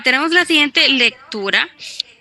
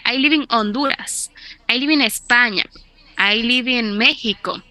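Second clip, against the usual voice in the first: English with a Spanish accent, "live" as "libi"; mock Spanish-accented English. Shown as a demonstration. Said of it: "pero pronunciando español".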